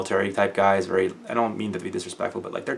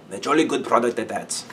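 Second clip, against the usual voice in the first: hammed-up Welsh lilt; playful put-on Welsh accent; overdone Welsh accent; 3 semitones higher than usual. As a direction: lay the Welsh accent on thick. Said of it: "his intentionally overdone accent".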